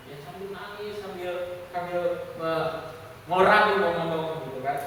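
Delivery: normal talking voice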